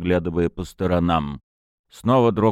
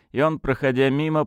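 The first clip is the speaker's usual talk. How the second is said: plain reading voice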